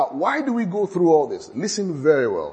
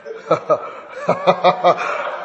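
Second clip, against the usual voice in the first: real unvoiced laugh at his own joke; breathy laugh; 2 semitones higher than usual. Real, not acted. Preacher laughing at his own Chelsea joke, real breathy laugh. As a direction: let a silent laugh shake out.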